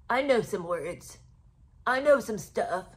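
slow, put-on know-it-all voice; mock his pride in knowing the lingo; mocking self-important show-off. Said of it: "Look at him using his little words".

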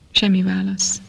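usual speaking voice

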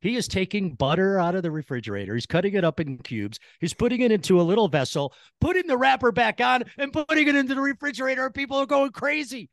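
rising to high-pitched excitement; excited. Retold or imitated. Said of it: "I pull my wife over and I'm like".

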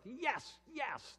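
high, loud, eager yes; excited yes. Acted. Son and Spirit cheering the Father's plan, excited high yes. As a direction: squeal it out with joy. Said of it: "the Son and the Spirit are so excited".

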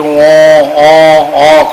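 long drawn-out loud wail like a newborn, slowed; imitated baby cry. Guru imitating a newborn's first cry for his audience, drawn-out loud wail. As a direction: cry out like a baby just born. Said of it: "করে চিৎকার করি".

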